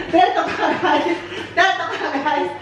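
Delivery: slow words through giggles; amused laughing repeat. Real, not acted. Laughing at being called devil.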